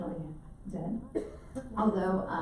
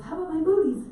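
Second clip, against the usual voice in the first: higher, louder friendly offer; bright offer; 9 semitones higher, 6 dB louder, faster than usual. Retold or imitated. Tide pooler offering booties.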